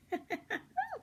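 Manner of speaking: high silly giggle. Real, not acted. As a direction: giggle at the pizza joke. Narrator laughs at own joke.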